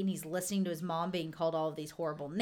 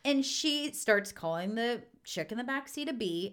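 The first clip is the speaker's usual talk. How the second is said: normal talking voice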